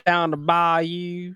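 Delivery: mock Southern accent. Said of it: "Southern accent".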